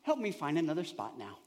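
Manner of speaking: mock serene surrender; calm prayer. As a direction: settle yourself and pray gently. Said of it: "I have to calm myself and center myself".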